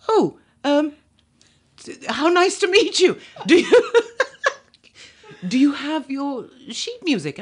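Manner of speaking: a startled Englishman stammering through courtesy; flustered British panel. Retold or imitated.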